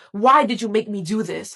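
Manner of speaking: a restrained version of screaming at someone; mild narrated scream. A narrator's reading. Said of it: "began screaming at her lifeless body".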